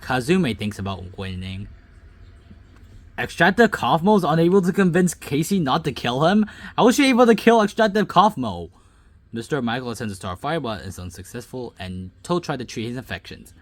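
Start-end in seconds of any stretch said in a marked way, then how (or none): 3.2-8.7 loud, high-pitched questioning disbelief; incredulous. Real, not acted. Gamer questioning an unlikely kill, incredulous. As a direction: demand to know how that happened.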